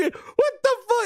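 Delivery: high, drawn-out mock disbelief; outraged Herman. Herman walking in on the art room, high outraged disbelief.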